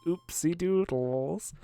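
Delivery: slow, sing-song cutesy oopsie. Pretend. To the viewers after the monster dies, cutesy sing-song oopsie. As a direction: coo it like a guilty child.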